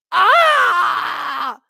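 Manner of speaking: high, loud sustained cry; loud scream. Acted. Reacting in the skit with a loud, high scream. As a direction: scream out at full volume.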